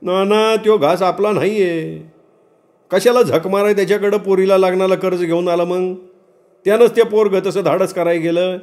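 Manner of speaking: high-pitched rustic voice of caution; high, earnest villager protest; dissuading villager. Acted. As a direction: talk him out of it, pitched high.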